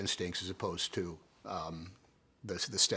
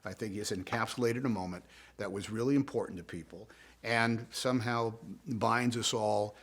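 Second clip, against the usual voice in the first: ordinary talk, nothing marked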